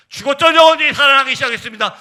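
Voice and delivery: high, very loud preaching cry; sudden impassioned burst; triumphant proclamation. Real, not acted. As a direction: proclaim it from the pulpit at full force.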